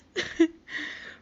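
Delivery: airy wheezing laugh; breathless laugh. Real, not acted. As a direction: laugh without voice. Laughs after shock.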